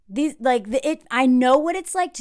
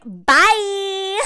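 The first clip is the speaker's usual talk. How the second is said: dismissive bye; 8 semitones higher, 6 dB louder than usual. Retold or imitated. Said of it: "She's like".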